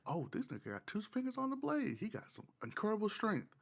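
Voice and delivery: ordinary talk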